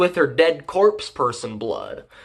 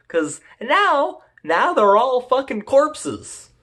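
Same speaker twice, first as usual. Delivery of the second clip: ordinary talk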